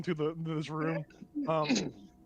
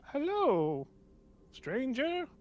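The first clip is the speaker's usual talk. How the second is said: higher-pitched, tentative gnome voice; gnome greeting; 9 semitones higher, much slower than usual. Acted. Gnome greets the stranger, high tentative voice.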